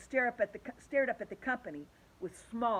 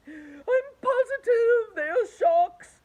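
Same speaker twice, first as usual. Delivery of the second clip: high, loud, slow crying wail; terrified insistence through tears; fearful earthworm; 13 semitones higher, 12 dB louder, much slower than usual. A narrator's reading. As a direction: wail it in dread. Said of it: "said the Earthworm".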